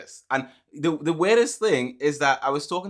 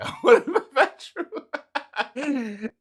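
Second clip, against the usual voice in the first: giggling through the admission; laughing confession; 14 semitones higher, 5 dB louder, much slower than usual. Real, not acted. Laughing as he admits the Xbox matters more.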